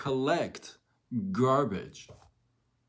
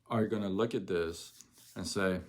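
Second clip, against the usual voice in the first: ordinary talk, nothing marked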